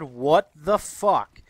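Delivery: loud, slow outburst; incredulous, drawn-out exclamation. Retold or imitated. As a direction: hit each word hard and slowly. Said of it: "I didn't say this in my head".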